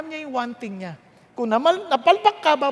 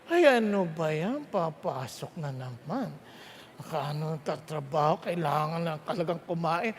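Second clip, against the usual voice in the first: groggy, much softer reluctant whine; grumbling; 9 semitones lower, 9 dB quieter than usual. Retold or imitated. Preacher mimics a worker waking up, grumbling softly. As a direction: moan like someone dreading another workday.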